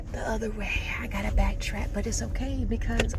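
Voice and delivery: soft unvoiced whisper; whispering a grumble about backtracking; whispered aside. Real, not acted. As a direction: breathe it to yourself under your breath. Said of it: "muttered aside".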